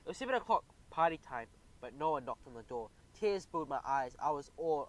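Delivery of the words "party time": plain reading voice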